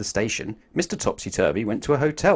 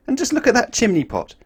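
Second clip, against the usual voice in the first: amazed look; 12 semitones higher, 5 dB louder than usual. A narrator's reading. Narrator exclaims to child listener.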